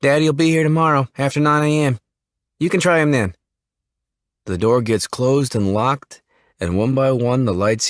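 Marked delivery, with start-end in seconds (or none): 0.0-3.3 put-on higher, hurried young man; lightly voiced jittery country boy; nervous Jimmy. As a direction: brush them off nervously. Jimmy closing door.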